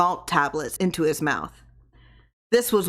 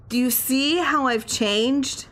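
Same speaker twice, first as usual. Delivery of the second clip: higher, slow, asking for praise; husband showing off to his wife, higher and slow; proud question; 7 semitones higher, slower than usual. A narrator's reading. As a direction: hold it up high and slow like a proud boast.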